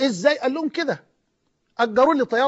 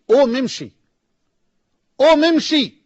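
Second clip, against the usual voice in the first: a commanding shout, higher and much louder; forceful call; 3 semitones higher, 7 dB louder than usual. Retold or imitated. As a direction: order him to his feet, hard. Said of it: "وبينادي علي بعنف". (نداء آمر بعنف)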